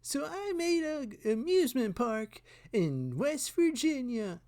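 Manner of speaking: high, loud YouTuber voice; hammed-up hyped YouTuber; MrBeast. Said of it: "I'm Mister Beast".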